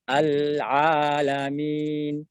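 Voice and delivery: very slow, soft al-alamin with a thin ain. Shown as a demonstration. Teacher showing students the soft, thin ain. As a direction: breathe al-alamin out gently and lightly. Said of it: "Ucapkan dengan lembut tipis".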